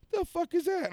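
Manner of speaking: shocked at a red dot on his own shirt; startled. Retold or imitated. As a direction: jump at something strange. Retold alarm at red dot.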